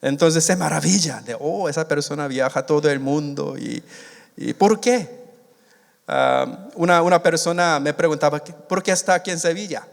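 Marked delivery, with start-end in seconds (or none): none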